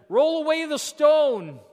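loud, high, drawn-out command; ringing preacher's proclamation; Jesus commanding. Acted. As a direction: call it out slowly with authority. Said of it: "Jesus went to the tomb and he says".

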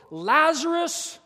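slow, loud, high call; booming drawn-out summons; Jesus summoning Lazarus. Acted. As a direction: call the dead out with a pause between. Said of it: "and he spoke".

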